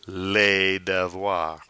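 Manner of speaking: "Les devoirs" opening on a long lay; drawn-out "Les devoirs", silent final s; plural article. Shown as a demonstration. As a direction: slowly stress "Les" in "Les devoirs". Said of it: "You'll hear it in a separate word".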